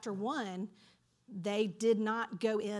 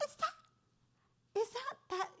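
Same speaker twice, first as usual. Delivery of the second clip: a high, soft, lightly put-on Southern accent; light mock Southern accent asking a question; 12 semitones higher, 5 dB quieter, slower than usual. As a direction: play a curious Southern neighbor, Southern drawl. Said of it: "happening in Southern accents".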